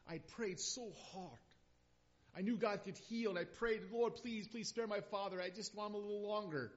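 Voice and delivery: high, loud cry of grief at God; anguished yell. Retold or imitated. Son alone in snowy woods yelling at God, anguished. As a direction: shout it up at the sky, pleading. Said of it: "I yelled".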